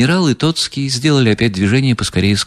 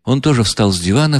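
plain reading voice

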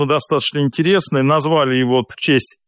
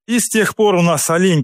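ordinary talk

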